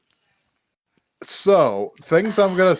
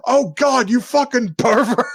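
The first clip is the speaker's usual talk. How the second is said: high, louder scandalised cry; mock appalled outburst; 8 semitones higher, 4 dB louder than usual. Sister accuses brother after a beat, high mock horror. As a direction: blurt it out in mock disgust.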